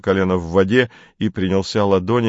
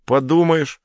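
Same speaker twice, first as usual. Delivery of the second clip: презрительно; 8 semitones higher than usual. A narrator's reading. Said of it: "Вальтер преисполнился презрения".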